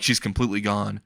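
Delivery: usual speaking voice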